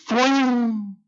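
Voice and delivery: high, drawn-out bowstring twang; imitated arrow twang. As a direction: mimic an arrow flying off the bow. Imitates an arrow being shot.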